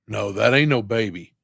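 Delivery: ordinary talk, nothing marked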